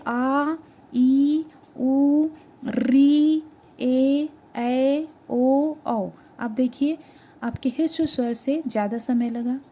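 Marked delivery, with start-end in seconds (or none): 0.1-6.1 each vowel आ, ई, उ, री drawn out to double length; long vowels demo. Shown as a demonstration. Teacher to students, showing long vowels: drawn-out vowels. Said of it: "आप एक बार फिर से उच्चारित करके देखिए".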